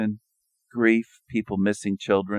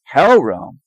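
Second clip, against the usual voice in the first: imagined Jesus line; 5 semitones higher, 8 dB louder than usual. Retold or imitated. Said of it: "Would he say".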